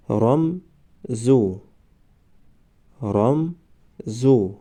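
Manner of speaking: رمزو stretched very slowly, syllable by syllable; drawn-out demo. Shown as a demonstration. Teacher modelling the word for students, very slow. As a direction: draw out رمزو slowly for the class. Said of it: "ما باید همان حرف را فقط آوازش را تلفظ کنیم".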